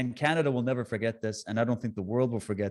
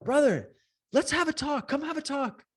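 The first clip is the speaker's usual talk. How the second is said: much higher, sweet, coaxing; friendly invite; 13 semitones higher than usual. Retold or imitated. Inviting counter-protesters to a hug, much higher and sweet. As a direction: coax like a cheerful peacemaker. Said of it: "I'm like".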